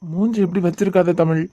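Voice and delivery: normal talking voice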